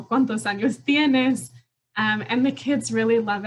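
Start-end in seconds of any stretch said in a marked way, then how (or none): 0.0-1.5 lightly voiced playful puppet, a bit higher; a hint of a silly puppet voice. Shown as a demonstration. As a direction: let the puppet pop up and greet the child. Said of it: "the puppet kind of pop out".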